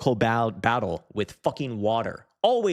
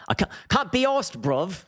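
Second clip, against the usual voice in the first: exaggerated chav accent, pitched way up; English chav accent; 10 semitones higher than usual. Streamer hamming up English chavs for his viewers, high chav accent. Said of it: "This is the chav speak that I've heard".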